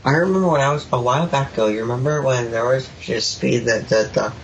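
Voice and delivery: drowsy, mumbling half-asleep speech; talking in his sleep, slurred and drifting. Real, not acted. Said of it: "Is he doing it again?".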